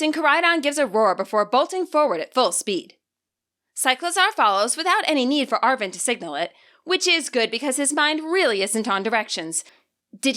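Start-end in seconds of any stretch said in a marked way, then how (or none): none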